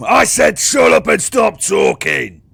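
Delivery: loud, high, slow bellow; angry guard shouting. Acted. Another guard at the door as prisoners talk, angry shout. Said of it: "another guard has ran".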